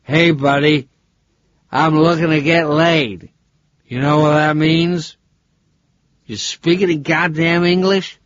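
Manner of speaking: a loud, boozy Yank shouting out of his depth; loud drunk tourist. Acted. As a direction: bellow at the cabbie like a sloppy drunk. Drunk American hailing taxi driver.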